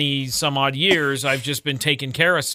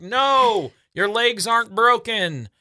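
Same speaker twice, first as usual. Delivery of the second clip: yelling back; 8 semitones higher, slower than usual. Retold or imitated. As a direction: call it back up the stairs firmly. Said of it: "And I yelled back".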